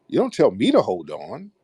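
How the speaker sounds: normal talking voice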